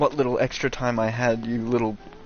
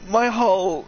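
high, loud disbelieving yell; real shocked outburst; 13 semitones higher, 4 dB louder, slower than usual. Real, not acted. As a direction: cry out in outrage at losing it all. Discovers the game progress is wiped.

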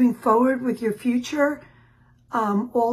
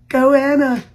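normal talking voice

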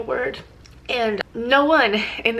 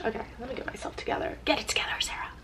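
fast whisper to herself; hushed self-pep talk, under her breath; 8 dB quieter, much faster than usual. Real, not acted. Calming herself after crying.

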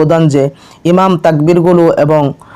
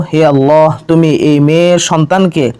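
ordinary talk, nothing marked

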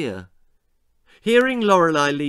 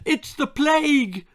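high wailing cry; mother wails over daughter's welts; 12 semitones higher, 3 dB louder than usual. A narrator's reading. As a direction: wail it in horror. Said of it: "wailed her mother".

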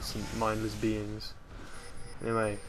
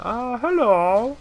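mock sweet greeting; 12 semitones higher, 9 dB louder, much slower than usual. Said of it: "And I'm like".

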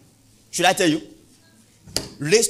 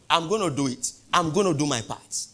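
normal talking voice

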